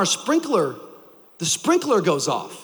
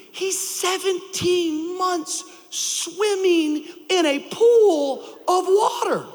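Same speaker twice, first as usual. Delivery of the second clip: preaching; 5 semitones higher than usual. Acted. Pastor preaching the lesson to the congregation, full force. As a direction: drive the lesson home from the pulpit.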